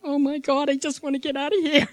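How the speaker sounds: acted rising panic; retold panic. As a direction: blurt it, desperate to escape. Retold panic, wants to flee.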